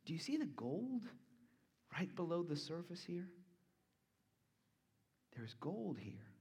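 dropped to a near hush, drawing them in. Real, not acted. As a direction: lean in and point it out softly. Preacher hushes to point out treasure.

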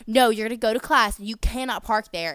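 loud mock scolding; retold scream. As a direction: bark it out like the angry worker. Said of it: "And she was screaming".

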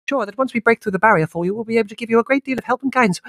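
very high, loud, rapid gush; breathless zealous lady; gushing spiritualist woman. Acted. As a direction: rattle it off in one breath, ending in a huge gasp.

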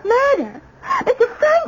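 shocked. Acted. Fiancée hears murder accusation.

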